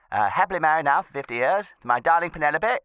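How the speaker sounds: ordinary delivery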